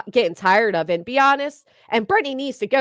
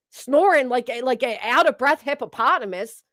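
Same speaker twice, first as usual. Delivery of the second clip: ordinary talk, nothing marked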